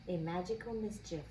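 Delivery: ordinary delivery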